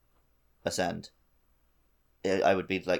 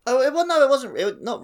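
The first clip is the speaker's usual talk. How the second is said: much higher, louder quick protest; surprised protest; 7 dB louder than usual. Real, not acted. Correcting co-host's mistake, surprised high protest. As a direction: jump in to correct him.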